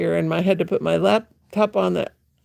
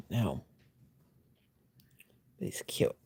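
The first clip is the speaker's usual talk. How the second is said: whispering; 11 semitones lower, 12 dB quieter than usual. Real, not acted. Soft aside about elf.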